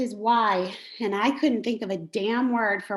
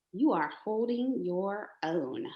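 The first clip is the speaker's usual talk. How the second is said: sincere praise; 4 semitones lower, 7 dB quieter than usual. Real, not acted. Sincere praise to struggling member.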